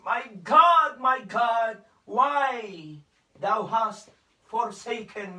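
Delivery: loud anguished cry of My God, then fading slow at forsaken. Retold or imitated. Preacher voicing Jesus crying out on the cross, loud anguished cry. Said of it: "bigla siyang sumigaw ng malakas".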